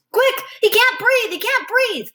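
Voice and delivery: high, loud alarmed yelling for help; frantic shout. Acted. Running for nurse, friend choking.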